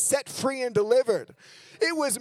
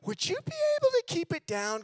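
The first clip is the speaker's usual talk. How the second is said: put-on higher, polite young girl voice; girl student; 7 semitones higher than usual. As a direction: ask sweetly but pointedly. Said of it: "And she's like".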